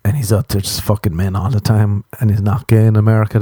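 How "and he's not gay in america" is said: ordinary talk, nothing marked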